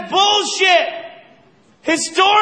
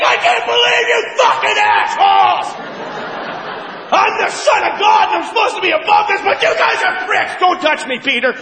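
furious Jesus; 5 semitones higher than usual. Acted. Jesus yelling at the money lenders, high and furious. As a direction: explode at the bankers. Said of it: "even Jesus on that one day was like".

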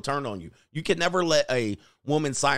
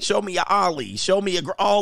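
ordinary talk

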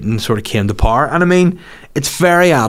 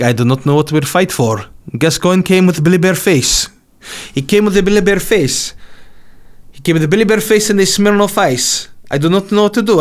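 baffled Russian; 7 semitones higher than usual. Retold or imitated. Bewildered Russian soldier voiced.

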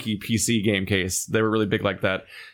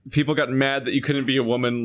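ordinary talk

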